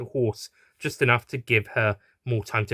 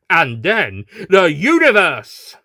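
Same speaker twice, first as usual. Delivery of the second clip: slow, loud, higher declaration; grand boast; 6 semitones higher, 9 dB louder, slower than usual. Acted. Horse villain declaring his plan, slow loud boast. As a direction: proclaim it grandly.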